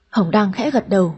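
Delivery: plain reading voice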